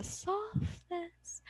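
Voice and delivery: "softness" hushed and drawn out; softness demo. Shown as a demonstration. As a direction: drop to a slow, soft "softness". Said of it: "when we say volume".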